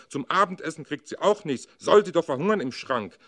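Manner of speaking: roaring father. A narrator's reading. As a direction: roar it at the whole family. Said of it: "brüllt der Vater". (brüllender Vater, viel lauter und höher)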